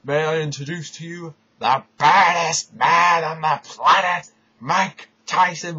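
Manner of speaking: loud, booming ring-announcer build with a slow name at the end; hammed-up wrestling promo voice; Vince McMahon announcing. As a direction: introduce the baddest man like a grand showman. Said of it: "he says".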